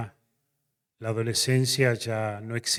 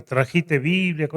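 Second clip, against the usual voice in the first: slow, louder, probing question; pointed question; 5 semitones higher, 8 dB louder, slower than usual. Retold or imitated. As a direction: ask it slowly and pointedly. Narrator questions the pastor.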